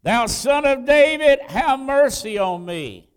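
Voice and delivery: loud, high cry for mercy. Acted. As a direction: cry out all the harder over the crowd. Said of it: "he cried so much the more".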